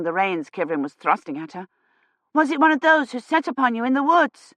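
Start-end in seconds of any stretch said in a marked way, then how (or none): 2.3-4.5 put-on child voice. A narrator's reading.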